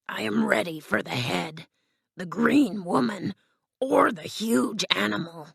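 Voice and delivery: husky, half-whispered lion voice; put-on husky lion, building up his courage; boasting Cowardly Lion. Lion boasts to his friends, husky creature voice.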